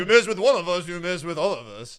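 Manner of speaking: a hint of a French accent; badly faked French accent. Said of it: "With a French accent".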